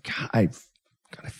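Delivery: hushed furious whisper. Real, not acted. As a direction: breathe the curse out, no voice. Fan whispers curse at coach.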